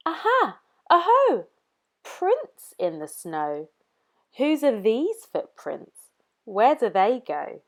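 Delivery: put-on a cheeky mouse copying the child.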